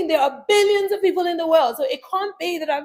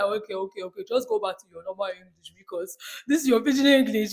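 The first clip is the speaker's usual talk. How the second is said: exasperated Nigerian listener; 8 semitones lower than usual. Retold or imitated. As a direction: imitate someone waving it off. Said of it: "they're the ones in the end".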